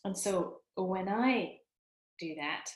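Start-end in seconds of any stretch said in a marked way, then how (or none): none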